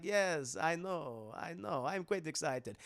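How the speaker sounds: slow, hushed vampire count; Dracula voice. Retold or imitated. Dracula greets the doctor, slow and hushed. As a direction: purr it like a courtly vampire.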